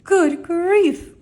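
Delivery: loud, high groan of dismay; exclamation de dépit. A narrator's reading. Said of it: "groaned the ones who had stars".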